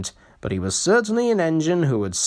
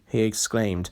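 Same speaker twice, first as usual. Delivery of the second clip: ordinary delivery, nothing marked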